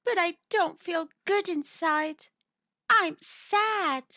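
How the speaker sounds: high, small-creature voice, a touch sad; sad little mole. Acted. Little mole confides in mamma, high and mildly sad.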